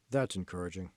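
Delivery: dry, low sarcastic mutter; flat sarcastic reply from the discouraged student. Acted. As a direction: say it with a deadpan sneer. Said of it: "Stop being sarcastic".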